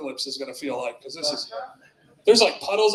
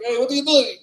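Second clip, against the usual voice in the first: raised, louder mock-French protest; bad French accent; 6 semitones higher, 6 dB louder than usual. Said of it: "That's not even a good French accent".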